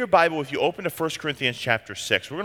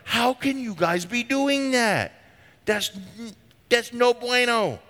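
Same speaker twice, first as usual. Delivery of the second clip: higher, slower, exasperated rebuke; mock exasperation; 8 semitones higher, slower than usual. Paul chiding the Corinthian believers, mock exasperated and drawn out. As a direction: throw up your hands at them.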